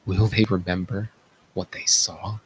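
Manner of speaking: ordinary talk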